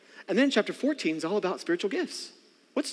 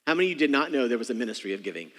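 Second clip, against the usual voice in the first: ordinary delivery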